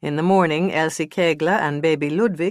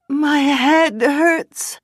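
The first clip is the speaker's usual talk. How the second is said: much higher, louder, very slow; theatrical; 11 semitones higher, 6 dB louder, much slower than usual. Acted. Witness theatrically dodging the interview. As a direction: ham up the headache. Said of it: "theatrically as she declared".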